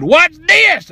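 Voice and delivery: very high, punched dare; defiant challenge. Retold or imitated. Upset customer to worker stopping him, defiant challenge. His defiant catchphrase, acted out.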